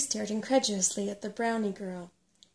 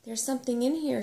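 higher, louder urgent warning; sharp, raised hiss of alarm; 4 semitones higher, 6 dB louder than usual. A narrator's reading. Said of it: "she hissed".

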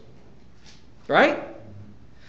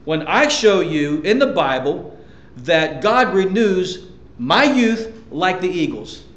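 usual speaking voice

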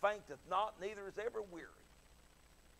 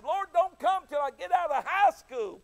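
comic pleading; 12 semitones higher, 14 dB louder than usual. Retold or imitated. Young preacher pleading to God.

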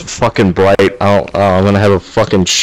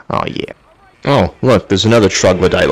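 normal talking voice

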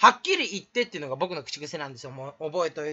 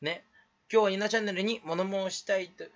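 ordinary talk

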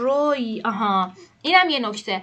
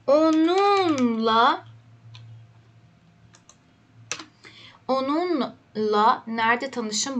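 very slow dictation; much slower than usual. Shown as a demonstration. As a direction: drag each syllable out like writing on the board. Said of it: "dictation pace for learners". (آهسته و کشیده برای شاگردان)

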